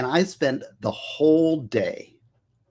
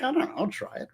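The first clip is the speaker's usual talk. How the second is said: unsure reply; 13 semitones higher, 7 dB quieter, faster than usual. Retold or imitated. His own retold reply to doubters.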